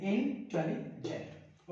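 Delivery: Intelligent broken into three slow beats, in-telli-gent; syllable by syllable. Shown as a demonstration. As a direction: say intelligent very slowly, pausing between its three parts. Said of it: "हम तीन बार में पढ़ रहे हैं". (Intelligent को तीन हिस्सों में रुक-रुक कर, धीरे बोलते हुए)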